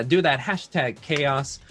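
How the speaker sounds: ordinary talk, nothing marked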